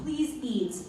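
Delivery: ordinary delivery, nothing marked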